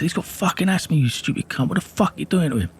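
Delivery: high-pitched indignant yell, replayed; retold angry protest. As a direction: tear into them in outraged disbelief. Protesting as police drag his brother.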